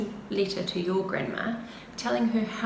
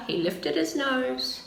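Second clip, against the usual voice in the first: ordinary delivery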